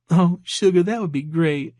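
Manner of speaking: high, perky Georgia waitress; flirty and sweet in a Georgia accent. A narrator's reading. Waitress accepting a dinner offer, flirty Georgia accent. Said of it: "an accent that was distinctly from Georgia".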